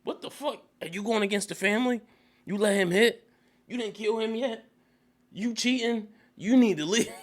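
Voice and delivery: high-pitched incredulous father; hammed-up scandalized questioning; mock outraged disbelief. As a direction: fire off stunned questions in a high voice. Father confronting his family, acted out.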